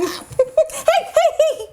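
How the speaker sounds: laughing "hey, hey" while being pinched; laughing protest. Real, not acted. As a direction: giggle through a playful protest.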